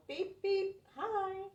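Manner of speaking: loud high horn beep. Acted. Truck greets the school bus with a loud high honk. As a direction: honk a cheery hello like a little truck. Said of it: "Little blue truck called".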